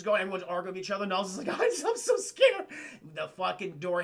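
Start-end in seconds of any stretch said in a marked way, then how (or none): 1.6-2.6 high-pitched, drawn-out squeal of fear; hammed-up scaredy-cat voice, loud and slow; mock frightened. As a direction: squeak it out like a terrified wimp. Acted frightened character in film recap.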